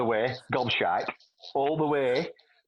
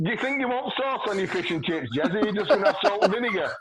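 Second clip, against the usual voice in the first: high, laughing, fast fish-and-chips question; mock posh offer, laughing high; laughing drunk question; 6 semitones higher than usual. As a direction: giggle it out tipsy. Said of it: "with Jez up saying".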